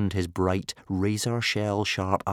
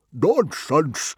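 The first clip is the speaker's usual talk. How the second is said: loud, high scornful dismissal; pooh-poohing Stoic; 8 semitones higher, 6 dB louder than usual. A narrator's reading. Stoic scoffing at old Wrinkly's omens, loud and dismissive. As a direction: brush it off like a boastful chief. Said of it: "pooh-poohed Stoic the Vast".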